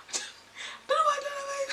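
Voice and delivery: very high, squeaky laughing voice; real laughing. Real, not acted. Vlogger cracks up at her own accent, squeaky laughing. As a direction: squeak it out helplessly through laughter.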